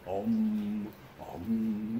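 low, slow drawn-out om; meditation om. Shown as a demonstration. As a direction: hum a long low om like meditating. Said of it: "you know, like that".

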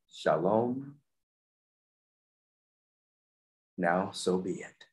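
slow, soaring prayer voice; spoken prayer. Acted. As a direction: pray it out, lifting key words.

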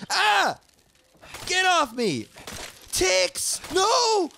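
high, loud panicked yelps; scared screams. Real, not acted. Startled by ticks, shrieking in fear. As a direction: shriek in real fright at the ticks. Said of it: "Guys, I'm s-, actually scared".

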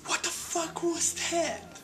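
high, slow angry yell from below; retold yell. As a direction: yell it up from the courtyard in shock. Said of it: "and yelled from the bottom".

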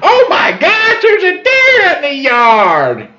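high, drawn-out squeal of alarm; hammed-up high-pitched exclamation; mock shriek. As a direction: imitate her squealing at the sight. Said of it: "and she goes".

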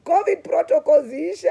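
loud, high and playful "covid protocols, ish"; excited joke. Retold or imitated. Greeting an old coworker before a hug, excited and loud. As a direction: toss it off, thrilled and teasing. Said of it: "I told him".

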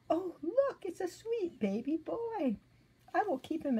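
high, bright-eyed gasp of wonder; delighted princess. A narrator's reading. Grandmother reading, voicing the princess finding the baby, high and delighted. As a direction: coo over the baby like a surprised princess.

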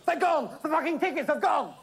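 high-pitched frantic wail; panicked outcry. A narrator's reading. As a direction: cry out that disaster has struck. Panic over the lost tickets.

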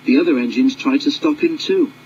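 ordinary delivery

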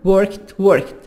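ordinary delivery

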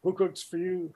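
a hint of a barred owl calling; owl call mnemonic. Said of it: "Supposed to be saying".

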